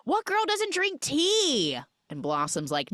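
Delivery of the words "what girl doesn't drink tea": high, slow mock yell; drawn-out, high-pitched mock holler; retold distant yell. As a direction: imitate someone calling out from far away. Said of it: "Ryan Reynolds yells from far".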